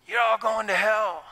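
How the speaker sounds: mock the harsh hell preacher; mock hellfire preacher. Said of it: "some guys are preaching it as if they".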